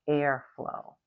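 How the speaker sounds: ordinary talk, nothing marked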